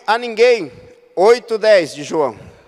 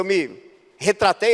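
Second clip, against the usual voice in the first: normal talking voice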